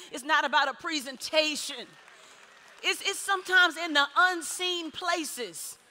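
emphatic preacher building in punchy contrasts; full-force preaching. Real, not acted. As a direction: drive each point home to the congregation, loudly.

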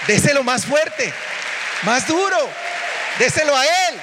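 high, loud rallying cry; shouted urging. Acted. Urging louder applause.

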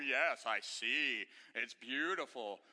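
a hint of the emperor faking wonder; fake-admiring emperor. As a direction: emperor pretends to see the magic cloth, slowly. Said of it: "he's like, Oh".

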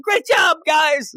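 very high, squealed cheer; hammed-up high-pitched yell; mock yelling praise. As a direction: shout it like an over-eager cheerleader. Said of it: "And she's yelling".

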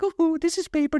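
very high, airy mock politeness; mock delicate. Showing how he does not recycle, very high mock-dainty voice. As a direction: parody a precious recycler in a tiny high voice. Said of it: "I'm not like".

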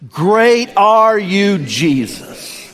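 loud, slow, drawn-out praise; congregation shout. Real, not acted. Pastor and church shouting praise together, loud and slow. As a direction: call it out together, stretched and strong. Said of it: "on the count of three".

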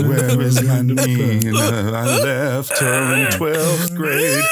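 hammed-up wailing, slow and loud; mock crying. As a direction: fake sob, dragging the words out.

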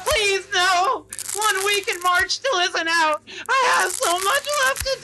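high, panicked begging; high whining plea; desperate pleading. Acted. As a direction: plead for your life. Begging while mauled.

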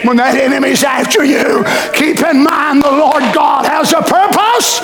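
preacher calling out to the congregation. Acted.

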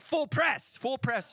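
high-pitched mock command; urgent urging. Holy Spirit urging the preacher on, high urgent mock command. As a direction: push it high, like egging someone on.

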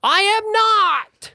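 high, loud slow snake denial. Acted. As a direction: stretch it out in a shrill snake voice.

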